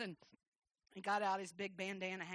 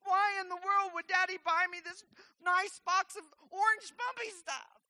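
high-pitched mock whine; disappointed child; 10 semitones higher, 7 dB louder than usual. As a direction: wail it like a let-down little girl. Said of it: "I'm disappointed".

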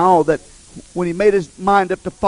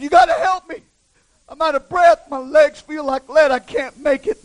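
high-pitched acted plea for help; urgent, high-voiced begging; desperate plea; 9 semitones higher than usual. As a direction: plead like an exhausted climber. Climber pleads with the Lord.

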